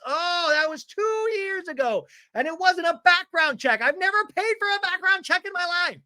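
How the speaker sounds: very high-pitched, hammed-up defensive whine; shrieky mock protest. Imitating Tamara denying the background check, high indignant protest.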